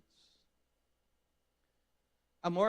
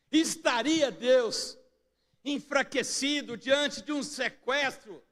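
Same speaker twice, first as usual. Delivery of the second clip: higher, louder pulpit delivery; impassioned preaching; 11 semitones higher, 7 dB louder than usual. Real, not acted. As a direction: preach it with force, voice lifted. Preaches at full force.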